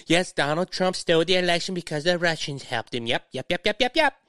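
mock droning that turns into rising yaps; mocking yapping. Podcaster mimicking opponents going on about the Russians, mocking yapping. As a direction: parrot them softly, then yap upward.

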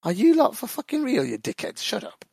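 high, mock-furious retort that drops off; retold outburst. Fan retelling snapping at critics of Thiago, mock outraged. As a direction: fire it back at the doubters, exasperated. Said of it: "And I was like".

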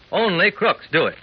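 usual speaking voice

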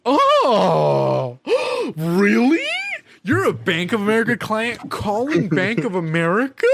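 big gasps and high, loud, sarcastic shock; mock amazement. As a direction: act hugely amazed at the obvious.

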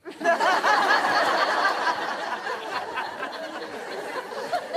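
rising burst of laughter; long laugh. Real, not acted. Preacher laughing at the man who already knows Jesus. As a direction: let a long laugh roll out.

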